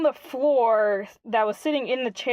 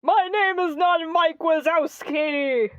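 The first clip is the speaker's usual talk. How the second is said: offended, raised voice; indignant shout; 6 semitones higher than usual. Acted. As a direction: correct them huffily. Guardian insists on his name.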